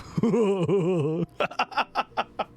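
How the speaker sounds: long real laugh. Real, not acted. Streamer cracking up at the margarita line, laughing. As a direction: crack up at your own bit.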